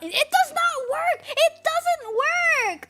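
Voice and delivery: very high, drawn-out wail of frustration; high-pitched indignant protest; upset. Real, not acted. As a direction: whine it out slowly in exasperation. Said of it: "I get so upset".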